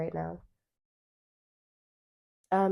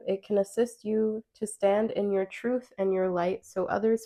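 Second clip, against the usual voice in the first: louder card reading voice; reading aloud; 4 dB louder than usual. Acted. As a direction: read the card text out clearly. Reads card text aloud.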